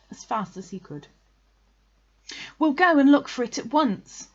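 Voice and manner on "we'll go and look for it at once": high, loud, urgent call. A narrator's reading. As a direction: shout it across to a friend. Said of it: "he called out to Eeyore".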